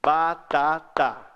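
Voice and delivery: "Batata" slowly as "ba, ta, ta"; slow, evenly split "Batata"; syllable by syllable. Shown as a demonstration. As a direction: give each syllable of "Batata" its own clap-like beat. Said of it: "Vamos bater aquela palma".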